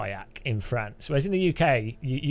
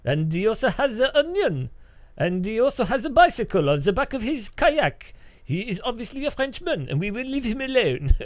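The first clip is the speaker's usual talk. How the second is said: high, loud mock French cop voice; mock French policeman catching a kayaker; 7 semitones higher, 4 dB louder than usual. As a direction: ham up a pompous French gendarme. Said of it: "they're like".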